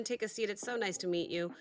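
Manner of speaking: usual speaking voice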